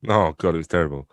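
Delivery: normal talking voice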